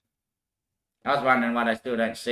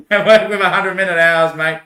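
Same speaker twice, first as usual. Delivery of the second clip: louder and higher, laughing; laughing insistence; 5 semitones higher, 8 dB louder than usual. Real, not acted. Correcting himself about hundred-minute hours, laughing loudly.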